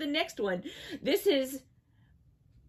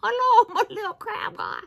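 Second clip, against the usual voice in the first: much higher, laughing hello; cutesy high hello to a crab toy; high cutesy greeting; 10 semitones higher than usual. Real, not acted. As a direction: coo at the little toy.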